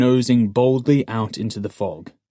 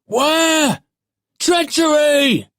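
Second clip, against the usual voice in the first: high, loud cry of alarm; shrill cry; 7 dB louder, much slower than usual. A narrator's reading. Young watchman cries treachery as he is attacked, shrill shout. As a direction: cry out shrilly, dragging the word out. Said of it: "he cried out, his shrill voice".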